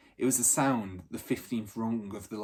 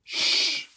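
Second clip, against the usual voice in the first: loud, high hissed shush; urgent warning hiss to the gang; sharp shush; 6 dB louder than usual. Acted. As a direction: silence them all at once. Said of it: "held up his hand and said".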